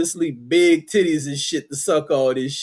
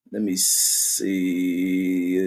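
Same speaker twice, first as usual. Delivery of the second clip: drawn out slowly; 8 semitones lower, 6 dB quieter, much slower than usual. Real, not acted. Searching on screen.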